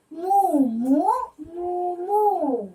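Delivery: playful chanted moo; mooing cow. Shown as a demonstration.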